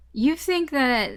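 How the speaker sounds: ordinary talk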